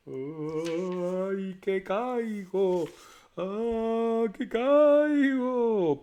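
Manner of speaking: high, very slow drawn-out moaning; ghostly wail. A narrator's reading. Ghostly voice in the night wails that it's falling. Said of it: "la voz siguió diciendo".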